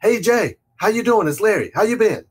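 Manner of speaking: match his energy, bright and quick; upbeat mirroring. Retold or imitated. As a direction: caller greeting a seller, brisk and friendly. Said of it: "So you gotta mirror and match people".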